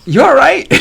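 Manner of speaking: high, loud mock-worried question; retold concerned check. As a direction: squeal it like a startled onlooker. Quotes himself checking on fallen rider.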